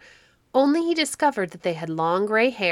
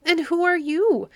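frightened scream; 5 semitones higher, slower than usual. A narrator's reading. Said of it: "screamed the three gray women".

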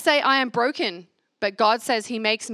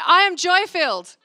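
high, loud, joyful declaration; more filled; 9 semitones higher, 8 dB louder than usual. Shown as a demonstration. Leading the crowd, saying it with more joy. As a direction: burst out with fuller joy. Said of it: "let's say it a little, like, more filled".

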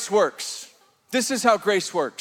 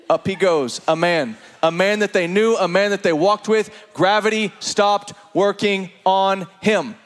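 usual speaking voice

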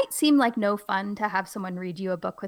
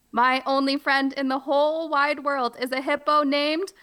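ordinary delivery

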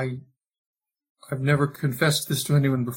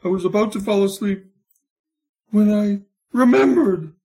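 anguished soap lament; 6 semitones higher than usual. Acted. Tormented doctor's lament.

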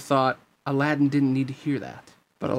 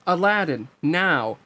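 raised, loud signal call; shout; 5 semitones higher, 5 dB louder, slower than usual. Acted. Sora signals the hidden Aladdin to jump, shouting. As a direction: call the cue out sharply. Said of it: "Sora shouted".

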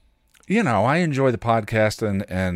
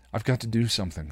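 plain reading voice

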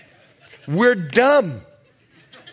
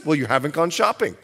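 wife's quoted reply; 6 semitones lower, faster than usual. Retold or imitated.

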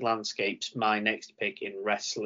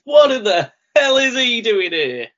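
high, loud, rushed disbelief; mock commentator outburst; imitating a frantic commentator; 10 semitones higher, 11 dB louder than usual. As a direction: yell it like a stunned announcer calling a surprise arrival. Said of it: "we have, with".

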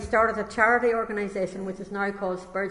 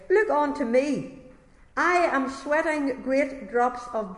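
impassioned preaching; 5 semitones higher, 3 dB louder than usual. Acted. Preacher calling his small congregation to look to Christ, fervent preaching. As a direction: plead like a preacher, stretching each "look".

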